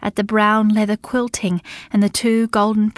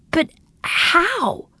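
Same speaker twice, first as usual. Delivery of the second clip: surprised; 9 semitones higher than usual. Acted. Bella in disbelief.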